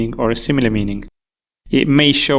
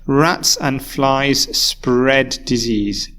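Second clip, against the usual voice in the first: slow careful reading; much slower than usual. Shown as a demonstration. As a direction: read the example very slowly. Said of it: "Let's look at the example sentence first".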